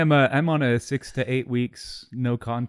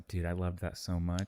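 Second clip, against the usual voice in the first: softer, lower and slow, savoring it; soft slow quote; 6 semitones lower, 12 dB quieter, slower than usual. Retold or imitated. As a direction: murmur it as a lingering confession. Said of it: "Kellen was like".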